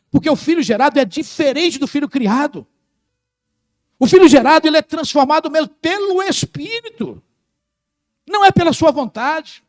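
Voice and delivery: high, loud preaching over the music; full-force preaching. Acted. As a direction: drive it home like a pulpit climax. (pregação inflamada, alta e forte)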